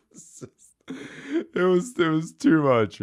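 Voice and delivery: high burst of laughter; laughing. Real, not acted. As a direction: crack up, then try to speak. Laughing hard.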